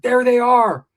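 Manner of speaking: loud, high mock cry; mom calling out. Imitating mom spotting missing boys, loud cry. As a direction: call out on spotting missing kids.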